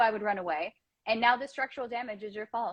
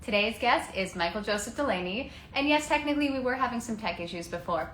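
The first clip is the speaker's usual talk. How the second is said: normal talking voice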